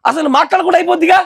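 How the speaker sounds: a preacher's loud mocking retort; emphatic loud repeat. Shown as a demonstration. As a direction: hammer the line home to the crowd.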